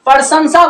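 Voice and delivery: प्रशंसा as "par-san-sa"; students' mispronunciation. Retold or imitated. Teacher imitates many students' error, mispronounced word. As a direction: move the r after the vowel in प्रशंसा. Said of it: "प्रशंसा बोलता है".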